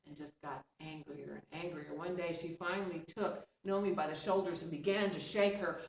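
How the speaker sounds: usual speaking voice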